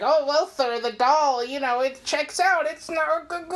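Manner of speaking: high-pitched, hammed-up nerd; squeaky mock-nerd impression, tripping over words. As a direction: stammer like a caricature nerd explaining to his boss. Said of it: "this fucking nerd is explaining".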